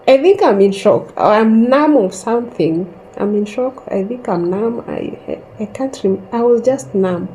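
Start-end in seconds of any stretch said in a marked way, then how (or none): none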